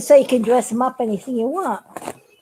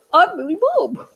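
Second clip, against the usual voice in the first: lightly voiced puppet says his name; a touch of a cheery puppet; 6 semitones higher than usual. Puppet Billy Bob greets the viewers, lightly voiced.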